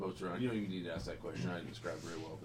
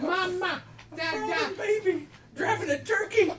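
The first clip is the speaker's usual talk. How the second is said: laughing; 16 dB louder, slower than usual. Real, not acted. GM loses it laughing.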